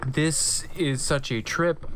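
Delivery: ordinary talk, nothing marked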